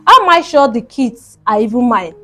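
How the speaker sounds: plain reading voice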